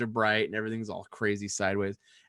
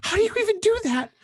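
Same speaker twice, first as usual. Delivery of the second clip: much higher, louder disbelieving wife; Robin in disbelief; 9 dB louder than usual. Retold or imitated. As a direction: squeal of shock at his news. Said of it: "She's like".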